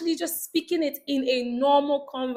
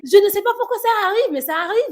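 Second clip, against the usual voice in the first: higher, louder French delivery; French accent; 5 semitones higher, 4 dB louder than usual. Shown as a demonstration. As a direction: switch into French-speaker mode. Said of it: "sound like a French person".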